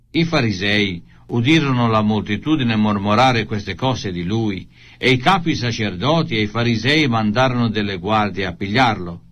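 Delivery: plain reading voice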